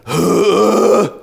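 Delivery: sharp mock gasping heave; retching gasp sound; imitated gasping noise. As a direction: imitate a man's strange gasping. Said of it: "I started hearing this noise".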